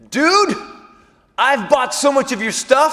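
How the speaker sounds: overexcited fanboy. Retold or imitated. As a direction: blurt it out like a starstruck fan. Shouting fanboy line at the Edge.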